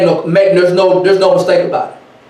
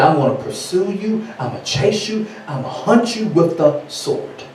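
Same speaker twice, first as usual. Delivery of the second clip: hushed, menacing God; voice of Yah; 6 dB quieter than usual. Acted. Yah threatening to pursue his people, quietly menacing. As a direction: deliver a soft threat as God. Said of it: "He said".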